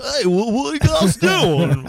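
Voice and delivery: mock Southern accent, high and loud; bad Southern accent. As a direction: imitate an actor's clumsy Southern accent. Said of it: "has a Southern accent".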